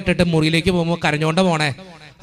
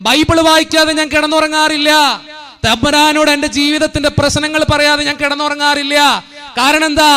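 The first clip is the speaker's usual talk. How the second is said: loud, high, impassioned sermon voice; fervent preaching; 7 semitones higher, 5 dB louder than usual. Acted. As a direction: preach it to the whole church with fire. Preaching at full force. (ഉച്ചത്തിൽ ആവേശത്തോടെയുള്ള പ്രസംഗം)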